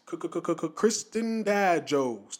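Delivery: slow stutter-echo segment intro; stuttered jingle intro. Shown as a demonstration. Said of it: "That's how I'm gonna do the segment".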